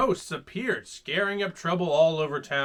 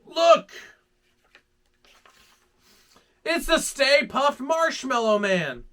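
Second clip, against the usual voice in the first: excited shout; 8 semitones higher, 5 dB louder than usual. Acted. Sees giant marshmallow man.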